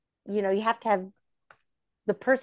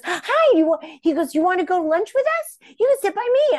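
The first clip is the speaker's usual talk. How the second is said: put-on very high, loud kid-like voice; childlike invite; 12 semitones higher, 7 dB louder than usual. Johnny invites her to lunch, very high childlike voice. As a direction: sound like an eager little kid.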